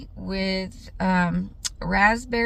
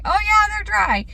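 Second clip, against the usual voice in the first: imitating son; 12 semitones higher, 5 dB louder than usual. Son's breezy reply voiced.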